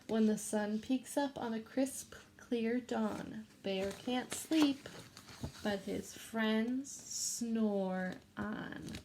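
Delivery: put-on reading a picture book to children; gentle read-aloud for a class; story reading. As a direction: read it like storytime for little kids.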